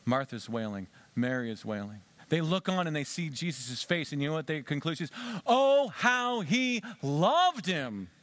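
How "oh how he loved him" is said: slow, high, tender "oh, how he loved him"; tender sigh. Acted. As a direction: linger over it like a sigh of pity. Mourners' tender remark.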